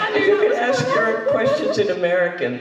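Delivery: high, giggling through the words; real laughter teasing the audience's accent; laughing. Real, not acted.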